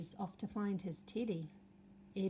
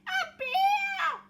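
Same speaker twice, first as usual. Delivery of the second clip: very high, loud terrified scream; Eddie screams; 12 dB louder than usual. Acted. Eddie seeing the giant bear, high terrified scream. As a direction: shriek it in terror. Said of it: "screamed Eddie".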